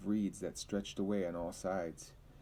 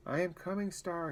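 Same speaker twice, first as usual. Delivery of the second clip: put-on a boy screaming to his drowning horse; high scream; 10 semitones higher than usual. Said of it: "he screamed".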